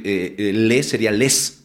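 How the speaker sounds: ordinary talk, nothing marked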